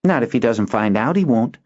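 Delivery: put-on sly boy. Boy plotting to hide it from his dad, mischievous. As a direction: conspire. Kinden voiced.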